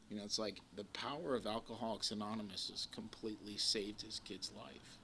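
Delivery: ordinary talk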